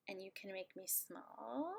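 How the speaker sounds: whispered small voice, as if shrunk; tiny whisper. Acted. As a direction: shrink the voice down to match small. Shrinking herself on screen.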